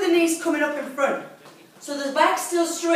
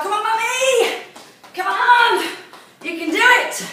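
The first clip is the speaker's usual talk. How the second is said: mock children shouting encouragement, higher and louder; kids cheering mum; 9 semitones higher, 5 dB louder than usual. Kids cheering their mum through the workout, mock shout. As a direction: play the kids egging mummy on. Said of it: "Tell them to shout".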